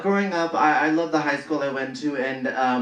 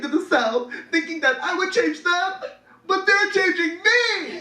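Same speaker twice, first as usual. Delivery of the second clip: mock tearful.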